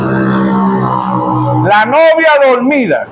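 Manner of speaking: "La novia dormida" dragged out very slow and low, half asleep; mock sleepy drawl. Shown as a demonstration. Preacher mimicking the sleeping bride, slow drowsy drawl. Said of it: "¿cómo es eso?".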